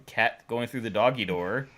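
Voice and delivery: ordinary delivery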